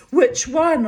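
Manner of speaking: high, loud imitated challenge; retold demand. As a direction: imitate him demanding an answer, loudly. Retold jealous demand from Pete.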